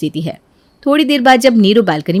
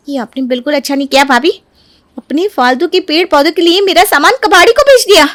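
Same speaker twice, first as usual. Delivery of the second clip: heated rebuke, rising higher; angry; 6 semitones higher than usual. Acted. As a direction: scold your sister-in-law indignantly. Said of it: "Neeru गुस्से में". (गुस्से में उलाहना, आवाज़ चढ़ती हुई)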